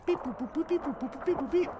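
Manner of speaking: high-pitched mock computer beeps; rapid little robot bleeps, high up; imitated machine beeps. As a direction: beep and boop like a machine. Imitates the analyzer running.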